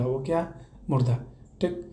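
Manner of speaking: usual speaking voice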